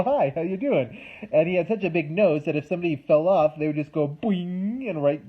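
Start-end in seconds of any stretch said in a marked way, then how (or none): 0.0-1.0 high, loud, cheery cartoon hello; goofy cliff greeting. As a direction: greet like a big-nosed friendly cliff. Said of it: "and he'd go".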